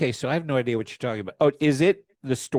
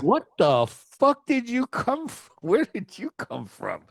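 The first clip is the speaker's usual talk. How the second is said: baffled listener; 10 semitones higher than usual. Retold or imitated. Mocking a listener baffled by the accent, high incredulous outburst. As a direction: squeal it out in disbelief. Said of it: "you're like".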